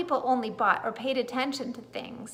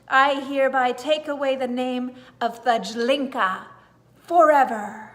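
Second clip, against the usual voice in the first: loud, ringing proclamation; clear compelling voice; 8 dB louder than usual. A narrator's reading. Old lady stripping the name away, loud and ringing. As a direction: pronounce it with solemn authority. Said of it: "clear, compelling voice".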